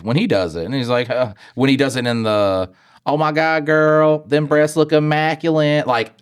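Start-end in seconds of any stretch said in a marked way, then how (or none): 3.1-5.9 high, hammed-up gushing; goofy mock compliment. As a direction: imitate a guy flirting as a joke. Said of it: "in a goofy way".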